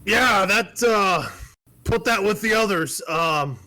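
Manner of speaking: high, loud gruff master smith; a loud old smith brushing off his apprentice; old smithy. Acted. Smithy voiced by GM.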